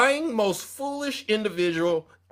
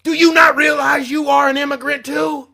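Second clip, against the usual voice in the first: louder and higher, yelling it at him; retold shout; 6 semitones higher, 6 dB louder than usual. Host retelling what he'd yell at Lou, loud. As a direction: throw the question at him like a shout. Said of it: "but I'm like".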